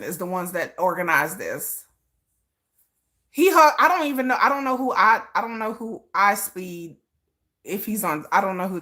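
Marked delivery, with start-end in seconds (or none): none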